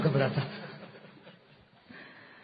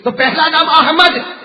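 loud, higher preaching at full force; fiery preaching; 7 semitones higher, 10 dB louder than usual. Acted. Preacher firing up the gathering about the saints, full-force preaching. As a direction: rouse the crowd, slow and booming.

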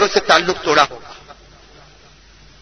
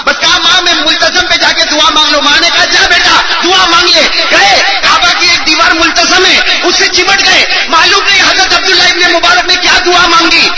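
high, raised storytelling cadence; full-force preaching; 9 semitones higher, 4 dB louder than usual. Real, not acted. Preacher telling of son's prayer at the Kaaba, forceful preaching. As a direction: keep the sermon story pressing forward loudly.

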